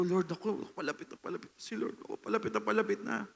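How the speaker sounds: soft, quick murmur of anticipation; hushed excited prayer. Retold or imitated. Drummer watching the Lord approach, quick hushed murmur. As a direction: whisper it fast as he comes near. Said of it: "Sabi ko".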